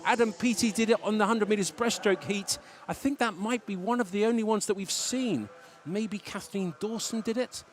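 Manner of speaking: loud, high, thrilled play-by-play; excited race commentary. Acted. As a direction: call the result with a rush of joy. Excited live race call.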